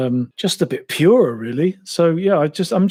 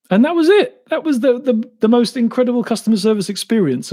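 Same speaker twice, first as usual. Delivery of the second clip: mock amazement; incredulous outburst; 8 semitones higher than usual. Real, not acted. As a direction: pitch it way up in sarcastic outrage. Sarcastic outrage at bad service.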